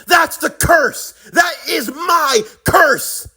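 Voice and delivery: high, impassioned shout; furious confession. Acted. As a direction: build the revelation into a raging cry. Acted confession of the curse.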